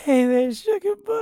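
high, giggly attempt at the line; laughing through it. Real, not acted. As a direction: try the line while losing it. Repeats line while cracking up.

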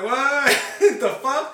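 stunned laugh; laughing disbelief. Retold or imitated. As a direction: blurt it out half laughing. Shocked reaction retold with laugh.

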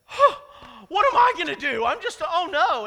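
sudden high, loud panicked cry; panicked king. Retold or imitated. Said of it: "He's scared to death".

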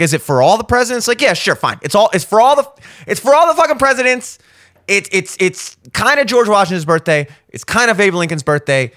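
usual speaking voice

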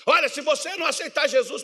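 mock hellfire preacher, harsh and loud. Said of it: "dura até pra falar de Jesus".

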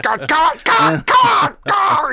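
high, loud shouting of God over and over; angry coach impression. Mimicking Chicago's coach losing it on the bench, furious shout. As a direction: blow up like the angriest dad alive. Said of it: "whipping his hands around like".

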